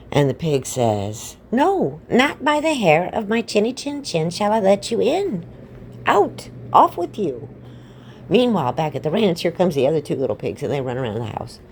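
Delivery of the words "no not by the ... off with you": high, slow, grandly indignant pig; storybook piglet standing firm; defiant pig. Acted. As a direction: pitch it up and banish the wolf. Pig sends the wolf away.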